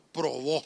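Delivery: "probó" with the accent landing on the last o; low-pitched "probó", stressing its final syllable. Shown as a demonstration. Pastor showing where the written accent goes, final-stressed "probó". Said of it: "con acento en la ova".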